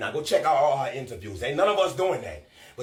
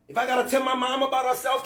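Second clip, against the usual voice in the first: normal talking voice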